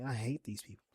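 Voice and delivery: softly voiced, grumbling aside; quiet, throwaway mock grumble; muttered retold grumble. Muttered aside voicing Zach.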